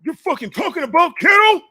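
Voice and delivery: high, loud mock outrage; a hater voiced way up high and loud; mocking internet troll. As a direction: squawk it like an angry commenter. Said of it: "some people tell me on the internet".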